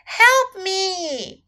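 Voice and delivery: high-pitched storybook cry for help; shouting for help. A narrator's reading. Little elephant trapped by crocodile, calling for help. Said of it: "shouted little elephant".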